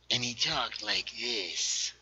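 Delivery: slow, put-on American villain accent; Americanized villain voice. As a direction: imitate an Americanized accent, drawn out. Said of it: "He had an Americanized accent".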